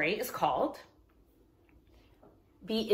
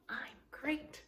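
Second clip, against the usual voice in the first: softly sung reply, a touch higher; soft answer; 5 semitones higher, 14 dB quieter than usual. Shown as a demonstration. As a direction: answer back gently for the kids. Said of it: "I want you to respond by saying".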